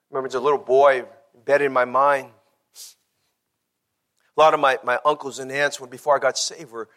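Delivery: ordinary talk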